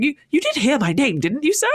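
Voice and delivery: pointed dwarf. Retold or imitated. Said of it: "the dwarf's going".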